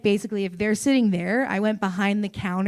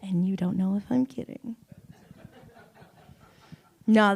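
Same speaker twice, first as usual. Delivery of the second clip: ordinary delivery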